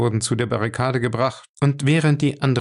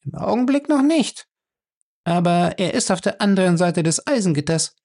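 much higher woman's voice, lightly taunting; Fay's voice; 8 semitones higher than usual. A narrator's reading. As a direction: tease him with the threat, as Fay. Narrator voices Fay.

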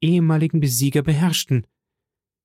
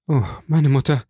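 deeply moved. A narrator's reading. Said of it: "rief Albert so bewegt, dass er kaum sprechen konnte".

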